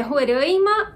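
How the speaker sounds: "Roraima" with nasal AI, ro-RÃI-ma; nasal variant. Shown as a demonstration. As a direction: nasalize the stressed vowel of "Roraima". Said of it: "falo Roraima". ("Roraima" com ditongo nasal, ro-RÃI-ma)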